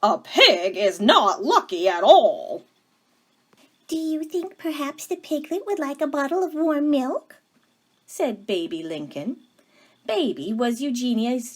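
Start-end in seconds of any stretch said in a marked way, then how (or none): 0.0-2.6 put-on firm, louder voice of a stern older sister; stern Eugenia. Eugenia scolds her sister. 3.9-7.4 higher, eager voice of a kind younger sister; sweet Baby Lincoln. Acted.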